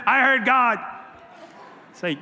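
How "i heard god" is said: high, loud mock-zealous exclamation; mock excitement. Preacher imitating people who weird him out, mock excited. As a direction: play an overeager believer, raised and loud.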